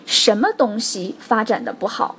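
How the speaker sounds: ordinary talk, nothing marked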